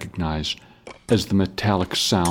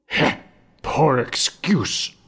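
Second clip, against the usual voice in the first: sneering mutter; 7 semitones higher, slower than usual. A narrator's reading. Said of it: "the soldier mutters".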